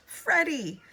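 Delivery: high, loud scream of the name. A narrator's reading. Elizabeth screams for Freddie, high and loud. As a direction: scream in alarm. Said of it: "Elizabeth screamed".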